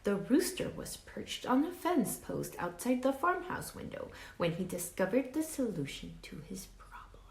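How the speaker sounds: put-on lively read-aloud for children; story narration. A narrator's reading. Teacher reading a storybook to her class, lively read-aloud. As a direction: tell it like a picture book to kids. Reads picture book to class.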